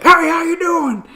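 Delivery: loud, high, drawn-out hey; slow hollered howdy, loud and high; shouted greeting. Retold or imitated. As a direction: bellow it across the field like a cowboy. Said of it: "I shout this".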